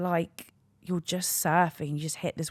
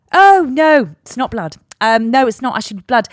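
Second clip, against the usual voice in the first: higher, loud mock denial; dismissive lodge receptionist; 7 semitones higher, 14 dB louder than usual. Lodge worker denying blood on the floor to a guest, dismissive. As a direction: play a receptionist loudly brushing off a complaint.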